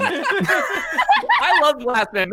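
slow, high, laughing through it. Real, not acted. Cohost laughing at Joe's shout-out, slow laugh. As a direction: laugh the words out. Laughing about Glass Man.

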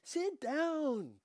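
high, loud bossy order; an older woman ordering everyone to sit; commanding. Acted. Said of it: "Dika commanded".

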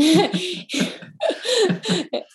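a burst of high bright laughter; real laugh. Real, not acted. Laughing at herself over the snot rags during yoga. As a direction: laugh out loud at yourself.

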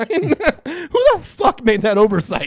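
high, loud, slow disbelieving outburst; stretched-out amazed question; incredulous laugh. Real, not acted. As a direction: laugh it out in mock outrage.

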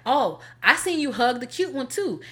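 quoting him with a lifted voice. Retold or imitated. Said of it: "And he was like".

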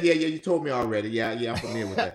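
rushed, impatient brush-off; dismissive. Shown as a demonstration. Listener cutting off a mourner's repeated story, dismissive. Models reply not to give.

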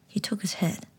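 ordinary delivery, nothing marked

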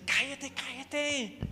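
high, slow, softened telling-off; hushing. Acted. Crowd telling the beggar to be quiet, hushing. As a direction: shush him in a high, drawn-out voice.

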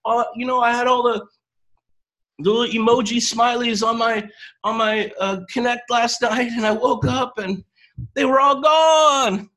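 high, bright gushing coach; excited new coach voice. Retold or imitated. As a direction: gush like an eager newbie, then wail. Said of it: "You're like".

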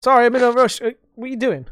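high loud mock apology; fake-sorry after yanking the door; mock innocent. Yanking the door on leaners, high fake apology. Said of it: "pull the door open".

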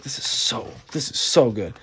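whispered this is so good; hushed awe. Retold or imitated. Mom recalling the book passage, awed whisper. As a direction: breathe it out in a whisper.

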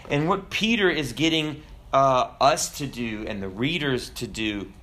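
ordinary delivery

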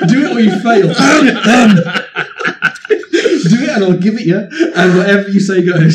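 high, loud words through laughter; laughing urging. Real, not acted. Game master daring player to continue, laughing loudly. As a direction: egg them on while laughing hard.

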